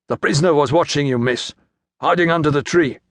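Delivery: fast, gruff report from a soldier. A narrator's reading. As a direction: gruffly brush off the lady, quickly. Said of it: "said gruffly".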